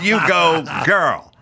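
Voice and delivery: hint of a cheering hype friend; lightly voiced cheer; sassy cheer. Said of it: "There's just".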